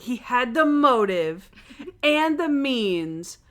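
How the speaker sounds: slow, drawn-out mock detective reveal, rising; dramatic detective summation. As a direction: lay out the case grandly, word by word. [name] acting as detective, summation.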